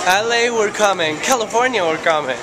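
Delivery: loud, bright cheer; calling out to the city; excited. Real, not acted.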